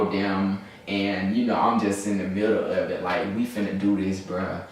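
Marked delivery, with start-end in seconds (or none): none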